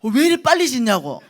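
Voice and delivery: high, very loud demand for an explanation; loud protest. Retold or imitated. Preacher re-enacts phoning about the rushed building, loud protest. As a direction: shout it as a comic, exasperated complaint.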